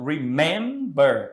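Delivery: "Remember" slowly, with MEM drawn out and punched; stress on second syllable. Shown as a demonstration. Teacher showing students the stressed syllable, "Remember" with strong MEM. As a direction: hit the middle of "Remember", syllables pulled apart. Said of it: "a tônica está na segunda sílaba".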